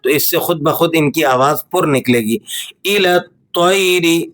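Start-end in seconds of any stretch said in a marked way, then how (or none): 2.8-4.2 الطَّيْرِ with rounded lips and a heavy ط. Shown as a demonstration. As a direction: read الطَّيْرِ thick and full. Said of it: "ہونٹوں کو آپ نے تھوڑا سا گول کر کے". (ہونٹ گول کر کے الطَّيْرِ موٹا)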